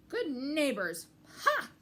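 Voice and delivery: loud sarcastic jeer ending in a high ha; mock the word neighbors; scornful scoff.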